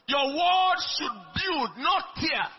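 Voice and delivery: shouted high and slow, punching each word; preaching shout. Real, not acted. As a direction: drive the point home with a shout. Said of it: "preacher emphasising".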